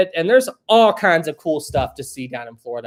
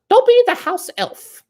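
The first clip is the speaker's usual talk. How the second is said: very high squeaky elf voice; high-pitched elf impression; Dobby the House Elf; 4 dB louder than usual. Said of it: "That was my Dolby the House Elf impression".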